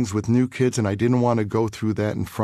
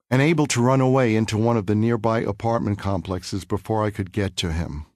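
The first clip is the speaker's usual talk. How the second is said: usual speaking voice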